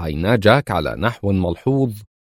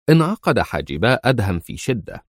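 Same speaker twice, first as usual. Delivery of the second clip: plain reading voice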